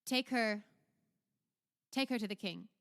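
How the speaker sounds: lightly acted command to guards; a hint of ducal authority; Duke's order.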